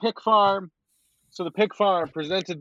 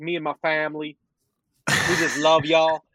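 put-on thick Southern accent; 3 semitones lower than usual. New coach wooing the LSU crowd, mock thick Southern accent. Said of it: "thick foghorn leghorn style Southern accent".

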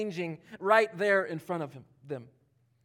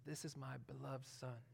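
hushed, intimate words meant for one son; spoken privately to him; 6 semitones lower, 21 dB quieter than usual. Retold or imitated. God speaking privately to Jesus at baptism, hushed. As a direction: say it softly as if only to him. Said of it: "he's talking to him".

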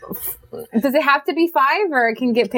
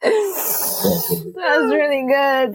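laughing tears; 3 semitones higher, much slower than usual. Real, not acted. Laughing so hard she's crying.

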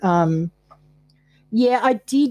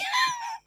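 high burst of laughter; 8 dB quieter than usual. Real, not acted. Laughs after punchline.

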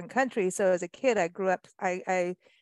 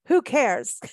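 cheeky title; 2 semitones higher, 6 dB louder than usual. Shown as a demonstration. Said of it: "not supposed to say it that way".

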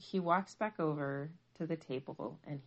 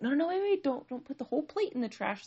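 higher, louder, urgent rush of no's; frantic warning; 9 semitones higher, 4 dB louder, faster than usual. Retold or imitated. Mom stopping her daughter trashing the plate, frantic warning. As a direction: blurt it to stop someone mid-action.

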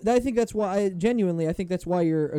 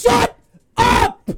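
high, loud shout; yelling; 8 dB louder than usual. Real, not acted. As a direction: yell it to cut him off. Yelling at Brad to stop.

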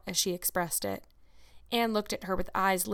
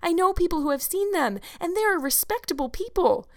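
put-on high, excited girlish storytelling; eager insistence; 7 semitones higher, 5 dB louder than usual. A narrator's reading. Anne tries to convince Marilla of ghosts, excitedly. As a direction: pile up the proof, pitch raised and earnest.

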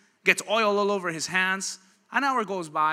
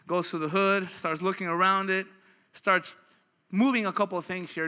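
ordinary delivery

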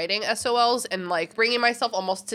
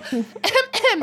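fake throat clear; 13 semitones higher, 3 dB louder than usual.

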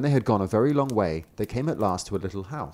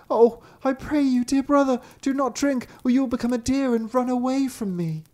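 high, louder pleading sister voice; 14 semitones higher, 3 dB louder than usual. A narrator's reading. Sister pleading with her brother at the third brook, high. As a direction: beg him one last time. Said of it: "The sister said".

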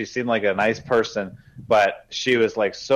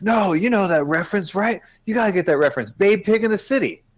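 high, eager gushing about a movie reference; high, eager fan voice pushing the reference; excited waitress; 11 semitones higher, 3 dB louder than usual. Retold or imitated. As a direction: sell the joke with bubbly enthusiasm. Waitress excitedly explaining her reference.

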